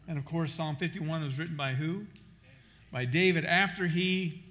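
normal talking voice

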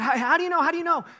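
put-on high, loud anxious child voice; child's worried question. Son Jet asking his dad how he knows, high and anxious. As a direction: plead like a scared little boy. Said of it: "I said".

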